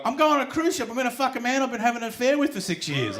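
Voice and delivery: high-pitched impression of an eager woman; woman oversharing. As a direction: gush it out in a high voice. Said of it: "I've had one woman be like".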